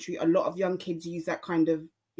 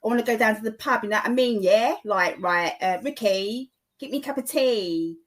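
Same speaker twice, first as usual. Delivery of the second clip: higher-pitched, matey Cockney accent; 5 semitones higher than usual. Shown as a demonstration. Podcaster showing the opposite of posh, broad Cockney accent. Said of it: "the opposite to that is a Cockney accent".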